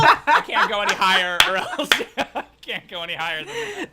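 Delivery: high, loud, laughing protest. Real, not acted. Player cracking up at a table joke, laughing. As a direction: say it through laughter, pitched right up.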